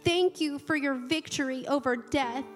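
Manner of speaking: normal talking voice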